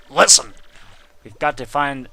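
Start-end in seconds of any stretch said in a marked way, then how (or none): 0.1-0.5 much louder, urgent command; insistent. Acted. Pressing Mario to listen, louder urgent call. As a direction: bark it to get attention. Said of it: "Mario, listen".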